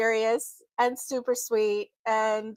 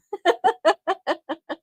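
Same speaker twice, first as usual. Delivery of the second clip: giggle; 6 semitones higher than usual. Real, not acted. Laugh after cake.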